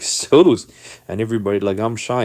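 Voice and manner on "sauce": high, loud mimicking "soos"; mocking crowd. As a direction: call it out high to tease. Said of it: "The people behind there are laughing".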